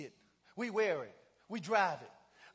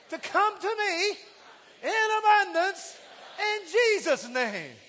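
louder, higher, slowing into a shout; shouted prayer; 5 semitones higher, 10 dB louder than usual. Acted. Preacher repeating the confession louder, near shouting. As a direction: cry it out in full preaching force. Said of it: "I wanna say that again".

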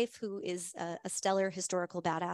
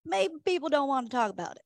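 put-on horrible Charlie Nelson accent; 4 dB louder than usual. Host retelling Charlie's joke to the tasting crowd, in a bad Charlie Nelson accent. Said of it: "I do a horrible Charlie Nelson accent".

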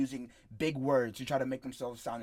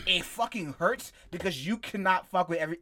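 ordinary talk

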